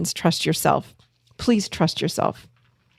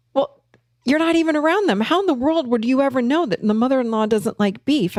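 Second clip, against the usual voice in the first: higher-pitched, mildly incredulous protest; retold disbelief, pitched up in protest; 6 semitones higher than usual. Therapist defending the client over the beef stew, incredulous.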